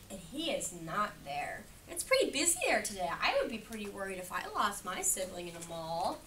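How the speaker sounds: ordinary talk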